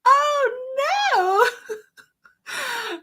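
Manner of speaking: high, laughing cry of dismay; half laugh, half wail. Real, not acted.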